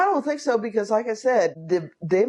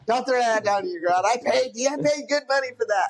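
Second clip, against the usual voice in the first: scolding parent; 7 semitones higher than usual. Pretend. Voicing a parent scolding a toddler.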